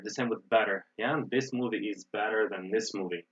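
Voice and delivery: ordinary talk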